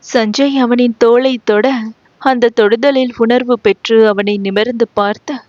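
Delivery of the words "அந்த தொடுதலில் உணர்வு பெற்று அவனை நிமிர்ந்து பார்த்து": ordinary delivery, nothing marked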